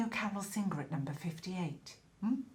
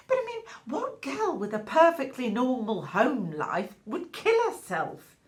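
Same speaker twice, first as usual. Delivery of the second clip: high, loud rhetorical question; scandalised disbelief; 5 semitones higher, 6 dB louder than usual. Acted. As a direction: challenge the listener, incredulous and theatrical. Gossip doubting the neighbour's account.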